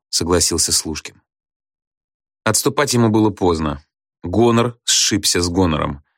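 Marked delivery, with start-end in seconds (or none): none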